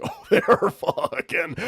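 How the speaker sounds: amused gasp of discovery; laughing thought. Acted. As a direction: giggle out the realisation.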